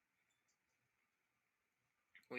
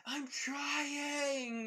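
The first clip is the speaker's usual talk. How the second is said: high, loud cry of effort; strained yell; 14 semitones higher, 4 dB louder than usual. Acted. Kaminari straining at the generator, yelling back high and loud. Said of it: "Kaminari yelling out".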